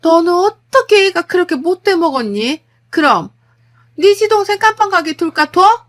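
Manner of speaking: high, loud, outraged scolding; shouting mother-in-law. Acted. Mother-in-law berating her over jailed brother-in-law, shouting. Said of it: "이러면서 소리를 지르시는 거예요".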